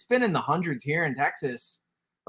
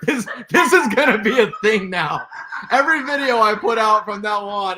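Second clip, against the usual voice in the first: laughing; 5 semitones higher, 7 dB louder than usual. Real, not acted. Laughing at the new nickname.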